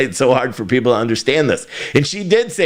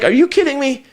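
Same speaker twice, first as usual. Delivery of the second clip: much higher, mock disbelief; incredulous; 14 semitones higher than usual. Replying to the CEO's post, much higher and incredulous. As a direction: squeak it out in exaggerated disbelief. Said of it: "And I'm like".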